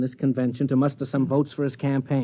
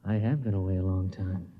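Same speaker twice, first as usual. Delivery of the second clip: tender murmur; 7 semitones lower, 5 dB quieter than usual. Acted. Husband just home after four months, after a kiss, tender and soft. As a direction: lower and softer, moved after the kiss.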